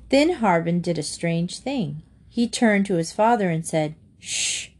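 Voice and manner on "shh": soft, urgent "shh"; quiet warning shush. A narrator's reading. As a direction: hush someone so as not to be overheard. Boy hushes his father.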